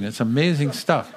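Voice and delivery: ordinary talk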